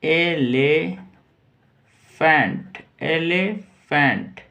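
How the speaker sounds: "एलिफेंट" said slowly, syllable by syllable; pronounce elephant. Shown as a demonstration. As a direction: spell out "एलिफेंट" in sound for the class. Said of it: "इसको बोलेंगे". ("एलिफेंट" धीरे-धीरे उच्चारण)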